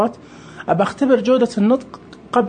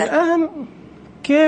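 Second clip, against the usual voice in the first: sudden high puzzled question; surprised; 10 semitones higher than usual. Real, not acted. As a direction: caught off guard, asking why. Purchase failed, confused reaction. (دهشة واستغراب)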